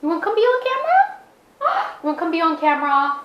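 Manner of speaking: high, fast cooing with a gasp. Real, not acted. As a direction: coo at the dog, delighted. Calling the dog again.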